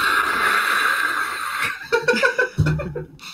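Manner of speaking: squeaky high laugh; high-pitched laugh. Real, not acted. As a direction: burst into a high giggle. Laughs at teammate's joke.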